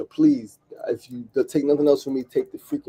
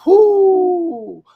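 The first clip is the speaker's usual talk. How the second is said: long, high held whoop; loud high whoo; 8 dB louder than usual. Real, not acted. Fan carried away by [name]'s lyrics, whooping high. Exclaims amid song lyrics.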